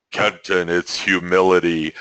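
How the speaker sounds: ordinary talk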